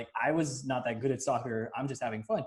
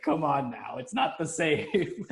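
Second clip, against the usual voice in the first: slow mock plea; slower than usual. Real, not acted. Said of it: "mock plea".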